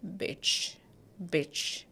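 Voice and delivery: the ending said as bitch, short i, soft; ending of garbage. Shown as a demonstration. Teacher isolating the garbage ending for students. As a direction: model the -bage ending as bitch. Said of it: "We'll say it is".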